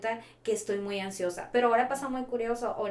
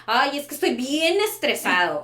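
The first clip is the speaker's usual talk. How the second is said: imitating a whiny child, a bit higher and louder, slow; mock stressed kid; 5 semitones higher, 8 dB louder than usual. Therapist mimics a stressed child at a maze task, slow whiny child voice. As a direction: drag out a kid's complaint.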